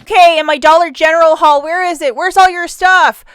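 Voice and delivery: loud, high pretend outcry; mock demanding. Vlogger mimicking viewers wanting the haul, loud mock demand. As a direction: call it out high, drawing out the end.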